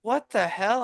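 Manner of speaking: mock startled friend; a hammed-up what the hell; retold shock. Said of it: "he goes".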